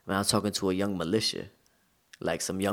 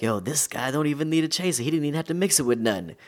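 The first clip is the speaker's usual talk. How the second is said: higher, louder, imitating amazed drinking buddies; amazed exclamation; 7 semitones higher, 6 dB louder than usual. Militia dudes marvel at his whiskey drinking, imitated amazement. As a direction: retell their astonished reaction, pitched up and loud.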